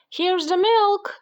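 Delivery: put-on high, drawn-out boyish call; excited shout. A narrator's reading. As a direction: call it out high and slow, as Jack. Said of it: "shouted Jack".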